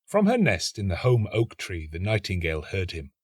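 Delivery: usual speaking voice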